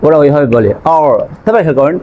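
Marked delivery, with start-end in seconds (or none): 1.0-1.1 অর with a strong rolled American r. Shown as a demonstration. Teacher shows the American অর. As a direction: curl the tongue on অর, American style. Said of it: "American ইংলিশে আমরা যেভাবে অর বলি".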